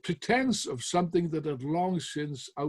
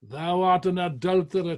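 loud, raised accusation; exclaimed insult; 4 semitones higher, 8 dB louder than usual. Retold or imitated. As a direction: fling the charge at him. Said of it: "To another he exclaimed".

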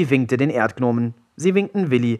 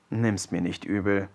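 slightly sad apology; 3 semitones lower, 7 dB quieter than usual. A narrator's reading. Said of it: "dessen Lächeln jetzt fast ein bisschen traurig wurde".

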